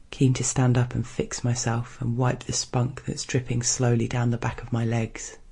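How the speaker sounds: plain reading voice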